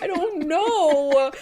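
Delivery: high, drawn-out mock wail; mock crying. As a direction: wail it slowly, imitating a crybaby. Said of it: "but you were crying".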